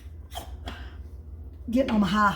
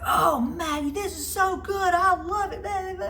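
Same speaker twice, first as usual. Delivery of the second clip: put-on much higher kid voice, gushing; delighted child; 10 semitones higher than usual. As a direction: play the boy who now loves it. Grandson voiced raving about food.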